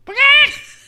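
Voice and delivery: sudden high, loud bird shriek; imitated peacock screech. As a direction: blast out a peacock call. Imitates the peacock just mentioned.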